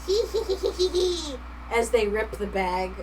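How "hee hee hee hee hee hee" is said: high, mischievous giggling; gremlin giggle. Pretend. Streamer voicing gremlins ripping the bag, high giggle. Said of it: "Gremlins be like".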